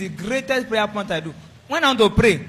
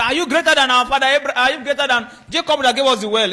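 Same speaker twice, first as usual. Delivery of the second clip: raised, loud disbelieving questions; incredulous challenge; 5 semitones higher, 6 dB louder than usual. Acted. Doubters questioning Jesus at the well, loudly incredulous. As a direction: challenge him as if outraged by the claim.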